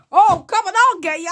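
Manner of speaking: high, very loud acted threat; mock combative shout. Reviewer acting the limbless knight's threat, high mock shout. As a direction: yell it as a hammed-up fighter.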